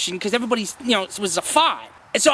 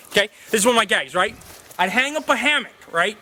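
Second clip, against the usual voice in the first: ordinary delivery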